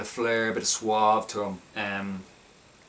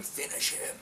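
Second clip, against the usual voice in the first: Mortal Kombat announcer; 9 dB quieter than usual. Retold or imitated. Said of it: "they sort of like Mortal Kombat".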